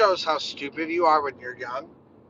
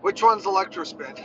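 usual speaking voice

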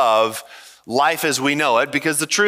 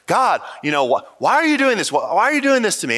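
retold plea to God, higher-pitched; imitated crying out; 6 semitones higher, faster than usual. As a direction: plead upward in mild mock anguish. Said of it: "constantly he's crying out".

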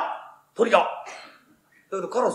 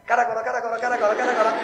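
normal talking voice

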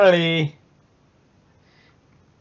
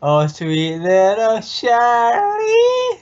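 put-on high baby talk; 9 semitones higher than usual.

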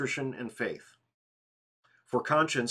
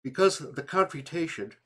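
through a yawn; 5 semitones higher than usual. Real, not acted. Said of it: "excuse me again".

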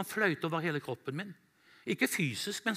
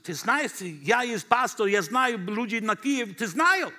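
higher, louder, slow and swaggering Russian; boastful pastor in Russian; 5 semitones higher, 6 dB louder, slower than usual. Retold or imitated. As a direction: puff yourself up before the soldiers. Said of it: "Litt sånn".

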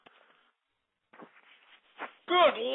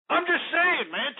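ordinary talk

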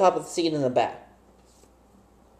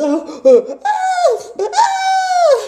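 loud, high burst of real laughter; 3 dB louder than usual. Real, not acted. Laughing at their own antics in the van, loud high burst of laughter.

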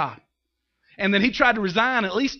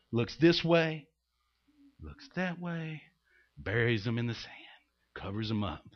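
much softer, lower hushed telling; secretive hush; 9 semitones lower, 9 dB quieter than usual. Retold or imitated. Preacher acting out Moses hiding the body, hushed. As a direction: tell it like a guilty secret.

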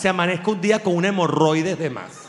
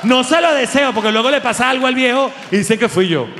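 loud mock protest; 6 semitones higher, 4 dB louder than usual. Joking about Biden dying.

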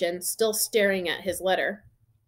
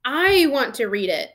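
raised, loud indignant demand; furious; 5 semitones higher, 5 dB louder than usual. A narrator's reading. Boy claims his own letter, furiously. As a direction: insist hotly on your right. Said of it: "said Harry furiously".

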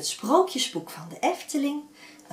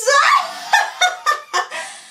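very high, loud witch cackle; long wicked laugh, falling; evil cackle; 11 dB louder than usual. Acted. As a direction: cackle triumphantly. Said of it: "Haar valse lach klonk verschrikkelijk".

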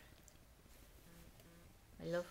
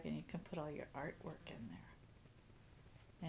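soft whisper; 3 semitones lower, 8 dB quieter, slower than usual. Real, not acted. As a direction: whisper it gently. Parent drops to whisper.

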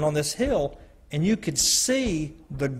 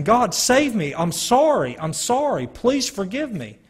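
retold pleading scream; 6 semitones higher than usual. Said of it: "I was screaming".

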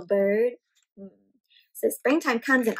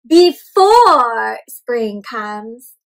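slow, drawn-out, louder read-aloud; storybook reading; 2 semitones lower, 8 dB louder, much slower than usual. A narrator's reading. Teacher reading a picture book to children, slow and drawn out. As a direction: stretch each word for the little listeners.

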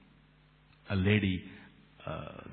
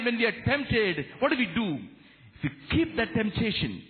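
high, ringing sermon delivery; fervent preacher; impassioned preaching; 13 semitones higher, 4 dB louder than usual. Real, not acted. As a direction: proclaim it to the congregation, pitched high and emphatic. Preaching at full force.

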